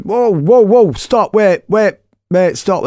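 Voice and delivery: high, loud string of whoas; urgent calling out. Retold or imitated. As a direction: call out to halt a moving car. Runs over to halt a driver.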